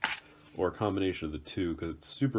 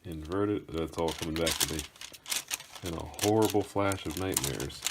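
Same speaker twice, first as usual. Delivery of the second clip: usual speaking voice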